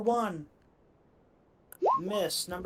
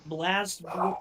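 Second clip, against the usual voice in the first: normal talking voice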